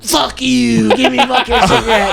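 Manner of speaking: loud, high imitated cursing; mock angry outburst. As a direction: lash out like an enraged street character. Acted enraged homeless man.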